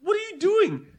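high, loud disbelief at what she's doing; incredulous disbelief. Retold or imitated. Reacting to the salt going back on the meat, incredulous. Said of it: "I'm like".

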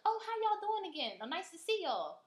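high, chirpy imitation of a saleslady; greeting saleslady. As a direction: act out an over-friendly dealership greeting. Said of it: "the lady would see her and be like".

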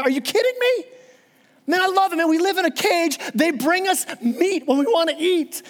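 high-pitched cartoon lion, delighted with captivity; incredulous lion. Preacher voicing the lion answering the zebra, incredulous. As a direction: play a lion astonished anyone would leave.

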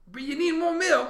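high, loud, badly faked New Zealand accent; Taika Waititi. Director pushes milk, failed New Zealand accent shouted. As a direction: attempt a Kiwi accent at a yell. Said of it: "do it in a New Zealand accent".

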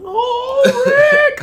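very high, loud whiny "Oh, Rick"; Morty voice. Retold or imitated. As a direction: whine like Morty. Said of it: "you know how Morty be bitching".

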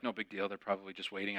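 calm yourself under your breath; muttered self-reassurance. Retold or imitated. Reassuring self in empty station.

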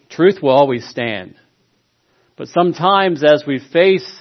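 preaching. Acted. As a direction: preach it with fervor. Preaching at full force.

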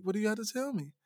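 hushed, wary question; imitated wary voice. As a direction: ask it softly, imitating a suspicious girlfriend. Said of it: "when they get that, that, that, that voice".

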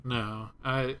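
ordinary talk

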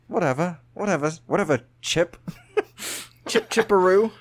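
cheeky British brush-off; mock British accent. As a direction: wave him off in a hammy British accent. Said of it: "Al loves my British accents".

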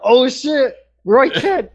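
retold excited yell. Recalling his outburst at Roy Kent's appearance, in a high retold yell. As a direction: re-enact a high-pitched mild yell. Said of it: "I did yell out".

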